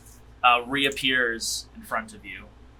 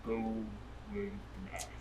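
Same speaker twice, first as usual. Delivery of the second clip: whispering; 13 dB quieter than usual. Acted. Spirit whispers back.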